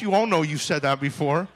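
ordinary talk

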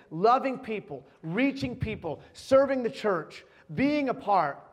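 loud, rising preacher's call; preaching at full force. Acted. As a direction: drive it home to the congregation.

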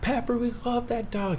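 hammed-up tearful plea in a high voice; mock crying. Eight-year-old mourning the family dog, mock crying. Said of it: "I'm crying".

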